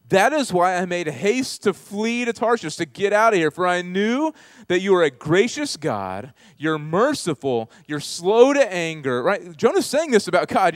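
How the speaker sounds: loud, high, impassioned sermon delivery; full-force preaching. Real, not acted. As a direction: preach it out to the whole room.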